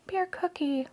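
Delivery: high, very soft squeak; tiny fond coo. Real, not acted. Collector admiring the bear's card, soft high coo. Cooing over the toy's card.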